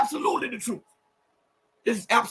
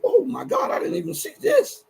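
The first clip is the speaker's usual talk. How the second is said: real surprise; 10 semitones higher than usual. Real, not acted. Surprised by updated page.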